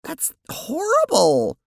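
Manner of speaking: horrified cry. Acted. Jade Sprite horrified.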